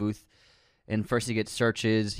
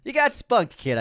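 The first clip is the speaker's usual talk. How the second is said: mobster voice; 11 semitones higher, 4 dB louder than usual. Retold or imitated. Imitating Falcone.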